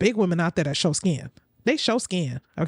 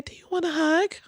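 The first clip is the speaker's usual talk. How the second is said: high sweet mock offer of a hug; 9 semitones higher than usual. Streamer mocking the guest's hug offer, high and sweet. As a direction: lift it up high and sugary. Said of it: "my dog is high off life".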